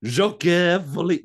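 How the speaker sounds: mock dramatic announcer. Joke title drawn out.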